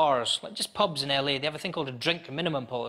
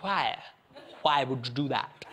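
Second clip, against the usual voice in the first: put-on drunk questioning the bouncer, over-enunciated; 4 semitones lower, slower than usual. Drunk asked to leave the bar, drunk voice. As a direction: play a drunk carefully forming each word. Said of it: "that's my drunk voice".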